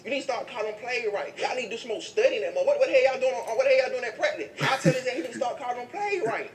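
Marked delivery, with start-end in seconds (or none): 2.6-6.5 high, loud scolding of the coach; half-laughing angry rant; mock rant at coach. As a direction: chew out the coach. Ranting at the head coach.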